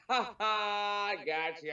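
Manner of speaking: high, slow laughing tease. Real, not acted. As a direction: laugh through the words. Jokes about a fake pick.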